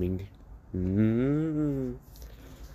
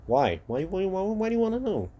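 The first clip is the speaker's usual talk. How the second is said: exasperated; 10 semitones higher than usual. Acted. Deku snaps at his father.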